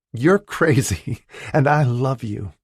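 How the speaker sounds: laughing and happy, high and loud, slow; happily. A narrator's reading. Sabrina to her fiancé, laughing happily. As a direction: laughs through the words of joy. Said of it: "she said happily".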